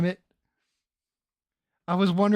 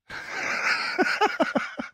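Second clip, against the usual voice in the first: high-pitched burst of laughter; real laughing fit; 4 dB quieter than usual. Real, not acted. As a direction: crack up helplessly. Cracks up at the mishap.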